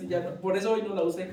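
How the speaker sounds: ordinary talk, nothing marked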